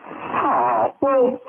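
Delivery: normal talking voice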